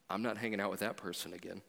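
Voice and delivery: low, quiet inner thought, muttered to yourself. Retold or imitated. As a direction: voice the unkind thought under your breath. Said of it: "internal conversation in your mind thinking".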